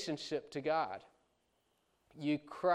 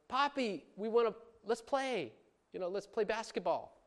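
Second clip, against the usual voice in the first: put-on higher pleading child's voice; eager child; 7 semitones higher than usual. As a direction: beg dad to play like a kid. Kids asking dad to play.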